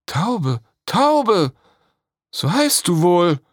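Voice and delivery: high, loud joyful call. Acted. Princess calling the dove by its name, loud call. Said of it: "rief Gislea".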